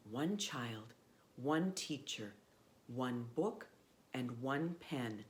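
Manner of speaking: put-on steady storybook read for children; reading a story aloud. A narrator's reading. Presenter reads Malala's story to young listeners, storybook voice. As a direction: tell it like a bedtime book.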